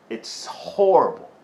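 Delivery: normal talking voice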